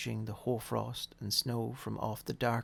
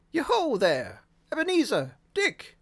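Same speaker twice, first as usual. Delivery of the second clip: calling out; 13 semitones higher, 8 dB louder than usual. A narrator's reading. Fezziwig calling his apprentices.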